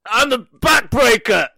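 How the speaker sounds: high, slow, hammed-up wrestler shout; The Undertaker. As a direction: belt out the catchphrase on cue. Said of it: "and I'm a backbreaker".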